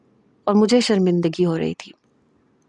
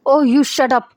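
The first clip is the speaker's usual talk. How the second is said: higher, louder angry shout; 5 semitones higher, 4 dB louder, much slower than usual. Acted. Natasha shouting at Zain in the car, loud angry outburst. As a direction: snap back as her patience breaks. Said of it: "میں چلائی تھی".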